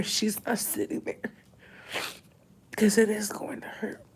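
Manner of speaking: whispered grief; tearful whisper. Real, not acted. Grieving daughter admitting the pain to viewers, tearful whisper.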